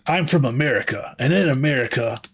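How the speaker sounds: lightly voiced drill instructor; a hint of a barking drill sergeant. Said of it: "like a drill instructor or something".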